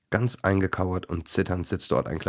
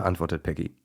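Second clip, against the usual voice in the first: ordinary delivery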